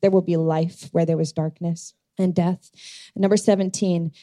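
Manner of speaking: ordinary delivery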